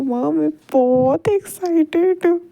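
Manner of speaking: high-pitched excited exclamation; acted thrilled outburst. A narrator's reading. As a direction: gush with excitement, pitch lifted. Character tells mother they are excited.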